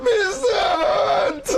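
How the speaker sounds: very slow, high, laughing shout; joyful shout. Retold or imitated. [name] greeting [name], stretched high, laughing shout. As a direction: stretch the name out in delight.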